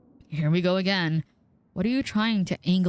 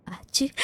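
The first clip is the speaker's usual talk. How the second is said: a real sudden sneeze; 7 semitones higher than usual. Real, not acted. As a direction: let a real sneeze burst out. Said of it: "I'm about to sneeze".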